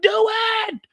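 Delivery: high, loud yelled Do it; screaming at him to shoot; retold shout. Man in the mud screaming at the shooter, loud high yell.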